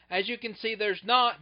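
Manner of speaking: ordinary delivery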